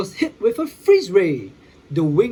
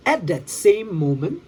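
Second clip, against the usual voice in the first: usual speaking voice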